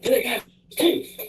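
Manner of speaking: high-pitched imitated holler, no real volume; stranger calling out. Stranger across the street repeats his tooth question, high mock call.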